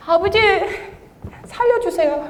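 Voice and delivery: high-pitched mock cry for rescue; helpless plea. Testifier playfully re-enacting her cry to God, mock pleading. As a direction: call out to father like a helpless child. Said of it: "그 이기는 방법이".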